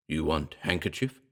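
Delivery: a hint of a talking gorilla; bestial ape voice. A narrator's reading. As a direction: rumble it like a big ape, softly. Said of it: "The intonation was bestial".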